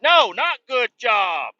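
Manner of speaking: high, loud, drawn-out objection; retold indignant yell; distressed mock protest. Protesting the neighbors' praise.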